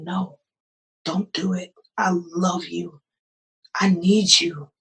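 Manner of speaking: soft, hushed divine voice; hushed loving command; quiet voice of God. Retold or imitated. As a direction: gently tell her not to do it. Voice of God in retold vision.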